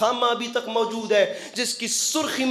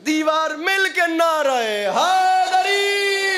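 slogan shout; 6 semitones higher, much slower than usual. Real, not acted. Leading the crowd's slogan.

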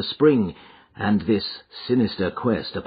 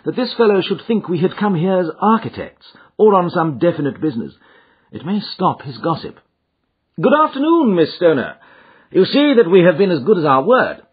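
higher-pitched detective, brisk and assured; explain, then greet the client heartily; confident raised-pitch sleuth; Holmes; 9 semitones higher, 6 dB louder than usual. A narrator's reading.

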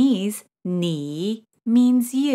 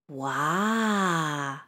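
interested wa; 4 semitones lower, 5 dB quieter than usual. Retold or imitated. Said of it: "show their interest by saying".

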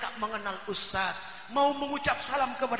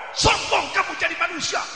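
loud scolding shout; angry rebuke; 5 semitones higher, 8 dB louder than usual. Retold or imitated. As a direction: yell it at him with contempt. Teriakan orang menegur. (teriakan marah menegur)

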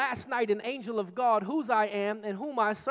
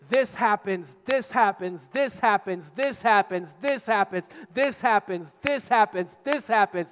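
each THIS punched and falling, again and again; hammered repetition; 5 dB louder than usual. Acted. Preacher pounding out life's troubles, stress on this. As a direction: pound the list like blows.

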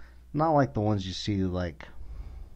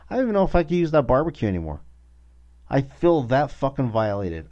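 fast, loud and higher; outraged rant; 6 semitones higher, 7 dB louder than usual. Real, not acted. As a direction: spill it out furious.

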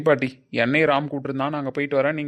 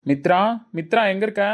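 higher, louder call for someone; 5 semitones higher, 6 dB louder than usual. Acted. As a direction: call out to bring her over. Calling Mitra out from elsewhere.

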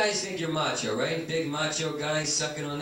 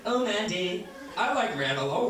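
usual speaking voice

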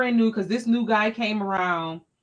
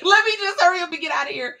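higher, fast, fleeing words; teacher escaping the principal's office, higher and fast; hurried exit; 9 semitones higher, 5 dB louder, faster than usual. Retold or imitated. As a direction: rush it out, fighting off tears. Rushing out holding back tears.

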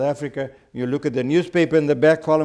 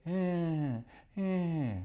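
high drawn-out whines; dog whine; 4 semitones higher, 8 dB quieter than usual. Retold or imitated. Storyteller imitating Fluffy answering the lady, soft whining. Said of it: "he whined like he was answering back".

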